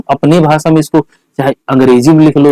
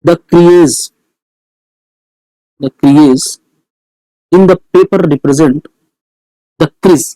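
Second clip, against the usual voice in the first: broken into short pieces with long pauses; slow dictation; much slower than usual. Shown as a demonstration. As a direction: repeat each piece for pupils writing it down. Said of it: "लिखवा देता हूँ". (रुक-रुककर इमला बोलना)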